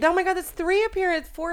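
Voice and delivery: high, loud burst of delight; excited. Real, not acted. Excited that the studio dog is back.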